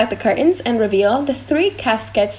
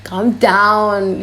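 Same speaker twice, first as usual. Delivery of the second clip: ordinary talk, nothing marked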